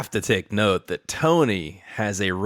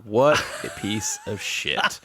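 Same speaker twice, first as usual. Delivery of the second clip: words broken up by a real laugh, pitched up; can't hold back the laugh while reading; reading the insult aloud and cracking up; laughing through it; 6 semitones higher, slower than usual. Real, not acted.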